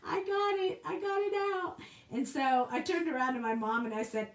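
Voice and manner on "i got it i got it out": much higher, through laughter; laughing relief. Real, not acted. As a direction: giggle out the triumph. Laughing relief after squirrel escapes.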